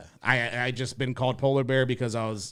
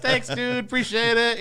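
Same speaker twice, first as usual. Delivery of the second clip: higher, laughing chill thanks; mock polite thanks; 12 semitones higher, 3 dB louder than usual. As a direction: brush off compliments kindly.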